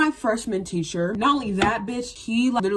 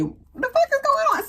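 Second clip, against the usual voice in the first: much higher, fast panicked question; alarmed shriek; faster than usual. Retold or imitated. To herself in the shower, panicked high question. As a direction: yelp in sudden alarm.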